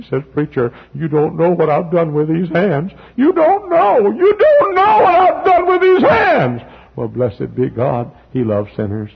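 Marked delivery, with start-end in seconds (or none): 0.3-6.6 high, loud broken cry, slowing; imitated weeping man. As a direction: wail it like a man confessing in tears. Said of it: "weep in my office".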